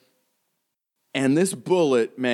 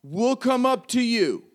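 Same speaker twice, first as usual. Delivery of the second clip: police authority; 12 semitones higher than usual. Acted. Policeman orders everyone to stay put, high authoritative call. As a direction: give orders from below, pitched up and measured. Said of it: "flexing his authority".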